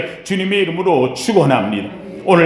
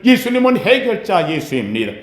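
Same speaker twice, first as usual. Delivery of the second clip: ordinary talk, nothing marked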